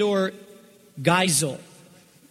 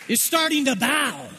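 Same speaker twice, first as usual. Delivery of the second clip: higher, driving preacher's voice; raised preaching cadence; 5 semitones higher than usual. Acted. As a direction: build the sermon with fire. Preaches with rising force.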